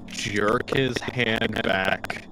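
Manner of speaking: normal talking voice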